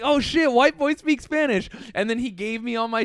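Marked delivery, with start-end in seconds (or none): 0.0-1.7 pitched up, louder, delighted surprise; impressed worker. Retold or imitated. Pizza guy thrilled the customer speaks Spanish, excited. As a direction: play the pumped pizza guy, amazed. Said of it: "he's like".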